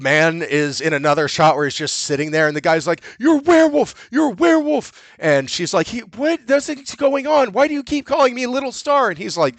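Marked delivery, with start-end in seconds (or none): 3.2-5.0 high-pitched, panicky accusation; hammed-up hysterical accusing; mock accusing yell. Retold man accusing old man. 5.9-9.1 high-pitched mock confusion; hammed-up baffled character; retold bewildered protest.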